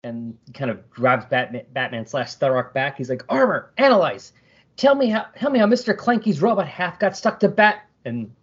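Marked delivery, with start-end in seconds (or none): 3.3-7.8 high-pitched urgent hero voice; Blue Beetle. Acted. Reader voicing a hero questioning his talking armor, high and urgent. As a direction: order the armor about, high and insistent.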